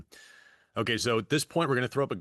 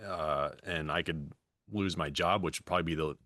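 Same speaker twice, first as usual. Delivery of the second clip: ordinary delivery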